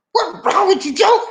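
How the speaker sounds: high, exasperated calling-out; pitched-way-up mock scolding; incredulous call-out. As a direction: shout up at him in disbelief. Calling out at the mugshot.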